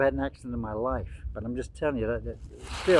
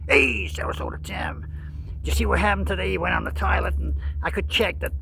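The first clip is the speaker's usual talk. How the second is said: Grifters Anonymous. Acted. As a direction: rant insistently in a hammed-up accuser's voice. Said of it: "Grifters Anonymous".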